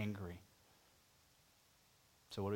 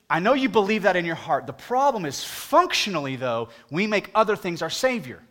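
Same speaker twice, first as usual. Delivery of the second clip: higher and louder, driving the point home; preaching; 6 semitones higher, 9 dB louder than usual. Real, not acted. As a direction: preach it to the whole room. Preaching at full force.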